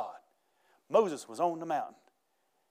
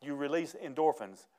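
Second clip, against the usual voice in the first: ordinary delivery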